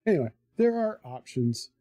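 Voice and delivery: ordinary talk, nothing marked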